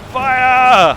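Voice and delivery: loud, high battle command; shouted order. Acted. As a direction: bellow the order to fire. Captain orders cannon fire.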